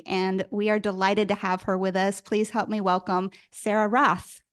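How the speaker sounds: ordinary delivery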